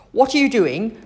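startling, cross demand from behind; snapped challenge. A narrator's reading. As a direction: catch her out with a sudden snap. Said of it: "snapped a voice behind her".

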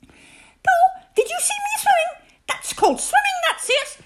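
very high, breathless-with-pride chatter; excited squeak. A narrator's reading. Roo boasts to each friend about swimming, squeaking excitedly. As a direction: squeal at everyone for attention. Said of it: "squeaked Roo excitedly".

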